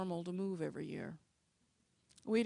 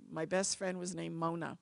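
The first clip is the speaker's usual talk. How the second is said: ordinary talk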